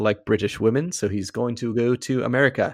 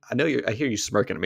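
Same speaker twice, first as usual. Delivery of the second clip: normal talking voice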